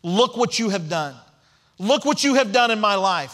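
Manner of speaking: building to a loud, high shout; shouting praise. Acted. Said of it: "shouting at the top of his lungs".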